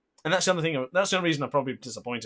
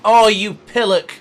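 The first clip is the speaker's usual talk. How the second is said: loud, furious insult at a rival; angry outburst; 5 semitones higher, 8 dB louder than usual. Real, not acted. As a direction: yell it as he gets knocked down. Rival knocks him off bike.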